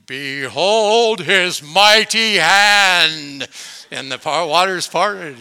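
booming, slow biblical proclamation; Charlton Heston impression. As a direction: boom it out like an epic film Moses. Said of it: "Charlton Heston ... I'll do my best".

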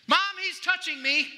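put-on high whiny kid tattling; whiny tattling little sibling. Sibling tattling in back seat.

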